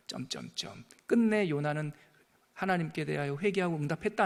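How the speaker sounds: normal talking voice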